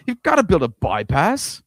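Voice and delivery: mock demand. Mock demand while laughing.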